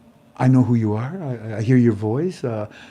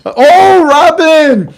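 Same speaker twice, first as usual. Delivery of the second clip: high, loud call of a name; excited shout; 14 dB louder, much slower than usual. Real, not acted. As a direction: call out in delight. Calling out a donor.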